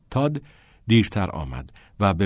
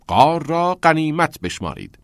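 the motto again, louder and higher; louder mocking repeat; 7 semitones higher, 5 dB louder than usual. A narrator's reading. Charlie gloats at the boys, mock-grand and louder. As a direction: hammer it home, gloating.

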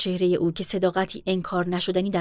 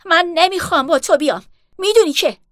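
much higher, much louder shout; 10 semitones higher, 10 dB louder than usual. A narrator's reading. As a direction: yell it after her, hurt and defiant. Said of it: "بری فریاد زد".

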